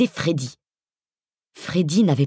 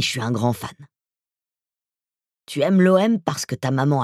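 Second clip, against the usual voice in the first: voice from beyond the grave. Acted. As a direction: speak like a menacing ghoul. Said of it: "sa voix d'outre-tombe".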